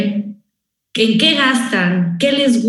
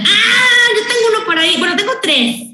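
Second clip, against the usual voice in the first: high cheeky shout; 7 semitones higher than usual. Retold or imitated. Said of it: "Y la China".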